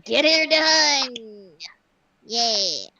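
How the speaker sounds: drawn-out celebratory shout; cheering. Real, not acted. Cheering a job well done.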